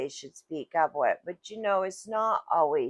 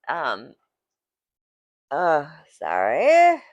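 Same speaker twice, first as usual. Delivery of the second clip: a slow, loud, choked sorry; tearful apology; 11 dB louder, slower than usual. Real, not acted. Apologising to viewers while crying. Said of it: "that is very emotional for me".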